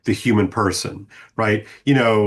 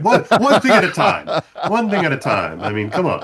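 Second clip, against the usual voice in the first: laughing; 5 semitones higher than usual. Real, not acted. Joking about multitasking.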